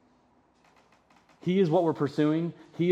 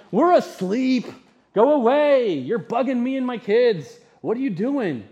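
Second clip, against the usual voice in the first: loud, higher, irritated sleepy neighbor; grumpy shout through the door; annoyed neighbor; 7 semitones higher, 6 dB louder than usual. Acted. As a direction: bark it at the late-night knocker. Preacher voices annoyed neighbor.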